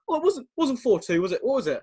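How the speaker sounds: mock confusion, pitched high; Jack Charlton. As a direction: imitate a muddled pundit doubting himself. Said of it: "Three times he checks himself".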